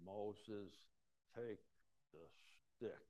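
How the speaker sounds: soft, slow, each word set apart; gently, one word at a time, like a patient father; loving manner. Retold or imitated. God speaking lovingly to Moses, soft and slow. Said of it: "in a loving manner".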